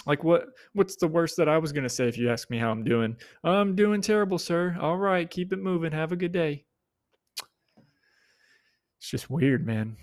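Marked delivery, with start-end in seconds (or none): 3.4-4.8 mock self answer. Imagined answer to stranger. 4.8-6.7 mock stranger reply. Imagined stranger's breezy reply.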